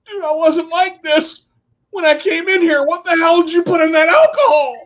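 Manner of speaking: high, hammed-up alarmed protest; mock panic. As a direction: fake outrage to sell the joke. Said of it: "I'm like".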